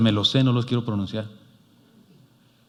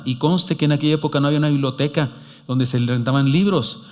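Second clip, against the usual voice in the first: normal talking voice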